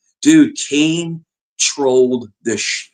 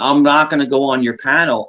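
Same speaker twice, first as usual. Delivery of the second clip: ordinary talk, nothing marked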